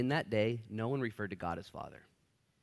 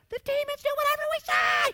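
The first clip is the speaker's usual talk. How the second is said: very high, loud excited cry; giddy boast; 12 dB louder than usual. Retold or imitated. Disciples boasting to Jesus, very high excited cry. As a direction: squeal it like a thrilled kid.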